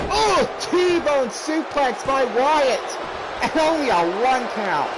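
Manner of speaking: drawn-out excited wrestling call. Real, not acted. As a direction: stretch it out like a big move call.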